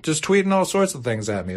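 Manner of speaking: normal talking voice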